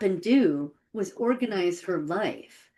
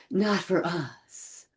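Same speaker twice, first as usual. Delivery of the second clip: "not for us", slow, with a long "us"; stress on us; 3 semitones lower, 6 dB quieter, much slower than usual. Retold or imitated. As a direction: slowly repeat the reply, drawing out "us". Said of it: "with the emphasis on the word us".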